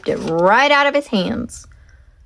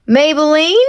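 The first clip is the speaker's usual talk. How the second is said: rising call into the dark; 6 semitones higher, 5 dB louder than usual. Acted. Leroy calling into the stormy dark. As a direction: call her name, unsure. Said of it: "said Leroy into the darkness".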